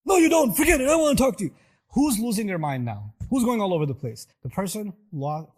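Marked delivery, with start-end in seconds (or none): 0.1-1.5 loud, high yelling refusal; furious parent. Retold or imitated. Parent losing their mind at child, furious yell. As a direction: storm off yelling.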